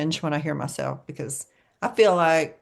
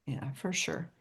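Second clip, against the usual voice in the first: whisper; 3 semitones lower, 13 dB quieter, slower than usual. Real, not acted. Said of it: "whispered agreement".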